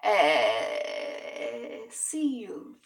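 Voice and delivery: playful, drawn-out mock-Spanish voice; silly put-on voice. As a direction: stretch it out in a silly voice. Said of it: "Why I do that with my voice?".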